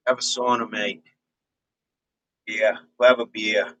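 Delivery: relaxed, low-key Australian accent; easygoing attempt at an Aussie accent; laid-back Australian, lightly put on. Shown as a demonstration. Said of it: "the, the Aussie".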